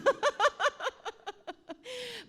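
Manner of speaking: long, high, loud peal of laughter; real cackle. Real, not acted. Auntie promises embarrassing stories, long high cackle. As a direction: burst into a high rattling laugh. Aunt laughs at own joke.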